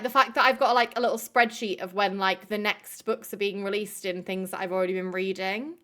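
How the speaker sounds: ordinary delivery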